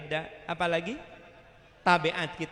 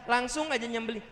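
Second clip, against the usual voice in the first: ordinary talk, nothing marked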